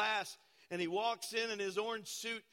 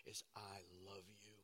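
softer, tender I love you; tender reassurance; 8 semitones lower, 9 dB quieter than usual. Retold or imitated. Pastor to the weeping young man in jail, soft and tender. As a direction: soothe him gently through the glass. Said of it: "and I said".

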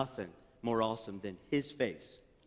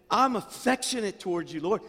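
pushed up louder and higher; the heartfelt, raised take; louder, more heartfelt; 6 semitones higher, 5 dB louder than usual. Shown as a demonstration. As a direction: say it again with more feeling.

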